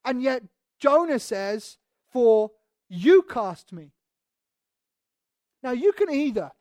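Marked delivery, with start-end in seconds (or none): 2.1-3.9 slow phrase with YOU drawn out and punched; stress on YOU. Acted. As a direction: lean hard and long on "you". Preacher highlights whom Jonah blames.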